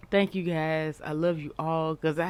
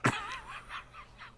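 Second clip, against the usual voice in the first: shrieking laugh; 8 dB quieter than usual. Real, not acted. Host laughing at clip.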